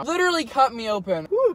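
loud high exclamation; excited exclaim. Real, not acted. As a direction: blurt it out, overwhelmed. Reaction after spicy shot.

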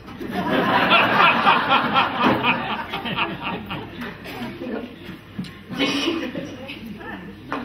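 long laugh. Real, not acted. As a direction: laugh hard at the memory. Laughs after telling dad's stunt.

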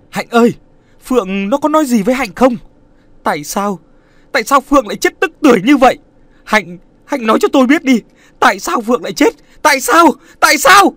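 desperate, half-screaming questions, fast at first; anguished pleading. Acted. Nam demanding answers from Hạnh about Phượng's death, anguished shouting. Nam begs to know why.

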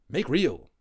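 high, loud eager plea; Little Bear pleading. A narrator's reading. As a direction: beg for her to be made real. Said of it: "he breathed".

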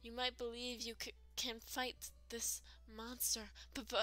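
halting plea broken by repeated stutters; hammed-up stammering warning to an adventurer; stammering frightened game character.

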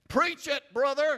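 high, loud shout of approval; loud, high preach it brother; loud self-cheer. Real, not acted. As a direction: yell it out like an amen corner. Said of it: "cheering himself on".

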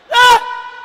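sudden high yelled Ah; piercing imitated scream; mock holler. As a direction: belt out a wild holler like the crazy brother. Brother hollering at breakfast.

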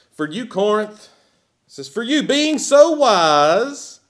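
sarcastic reading of Paul to Corinth. Acted. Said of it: "remember that sarcasm".

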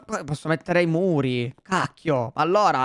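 ordinary talk, nothing marked

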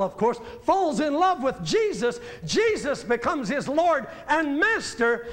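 ordinary talk

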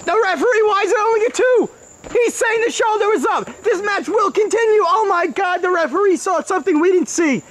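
high excited play-by-play; wrestling commentator. Acted. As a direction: call the near fall. Excited call of the count.

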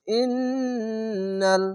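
slow, long-drawn chanted syllables; tajweed recitation. Shown as a demonstration. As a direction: stretch every sound in recitation. Recites again after rules.

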